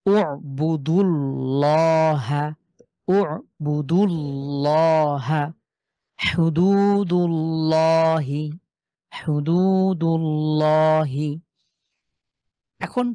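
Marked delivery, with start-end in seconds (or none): 0.0-11.4 উবুদুল্লাহা and হুদুদুল্লাহি very slowly, recited, with a thick l; heavy l recitation. Shown as a demonstration. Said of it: "সহজ করে আমরা লর মতন করে পড়ছি".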